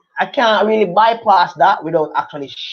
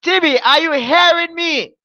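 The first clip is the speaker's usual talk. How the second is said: mock calling to the hard of hearing; 12 semitones higher than usual. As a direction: playfully holler as if to someone half deaf. Calls to the old student.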